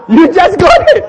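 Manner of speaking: high, loud laughing delivery. Real, not acted. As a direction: laugh through the punchline. Laughing punchline.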